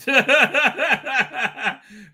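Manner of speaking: high, rippling burst of laughter; hearty laugh. Real, not acted. Host after telling Russell off, laughing. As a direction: crack up after your own outburst.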